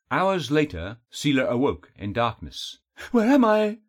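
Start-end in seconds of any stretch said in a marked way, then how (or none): 3.1-3.8 high, loud cry of confusion; startled, high-pitched call into the dark; alarmed cry. A narrator's reading. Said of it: "she cried".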